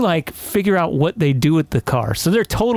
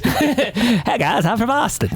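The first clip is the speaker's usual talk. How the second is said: higher put-on Boston accent; 7 semitones higher, slower than usual. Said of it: "try to do a Boston accent".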